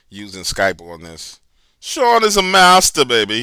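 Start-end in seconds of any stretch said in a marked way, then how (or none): 1.8-3.4 loud, high and slow praise; excited. Real, not acted. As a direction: cheer him on, big and drawn out. Hyping up the co-host.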